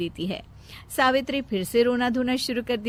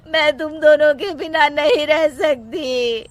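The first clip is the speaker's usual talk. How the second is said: loud, higher tearful wail; crying; 4 semitones higher, 8 dB louder than usual. Acted. Mother begging sons not to leave, crying. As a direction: wail through acted sobs. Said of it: "अपनी सास के आंसू देख".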